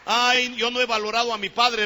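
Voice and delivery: high, loud cry of regret; loud lament. Acted. Prodigal son regretting how he treated his father, loud lament. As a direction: cry it out at preaching volume. Preacher cries out the son's regret.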